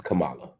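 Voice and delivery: Kamala as the forbidden kuh-MAH-la, middle stressed; mispronounced Kamala. Shown as a demonstration. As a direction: demonstrate Kamala wrongly stressed on the second syllable. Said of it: "what you can't say".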